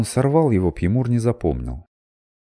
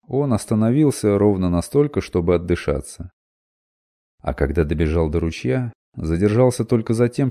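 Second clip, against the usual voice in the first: plain reading voice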